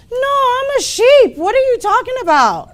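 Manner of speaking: high, loud protesting little cub; young cub. Acted. Young cub insisting to the older lion, high protest. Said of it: "And the young cub goes".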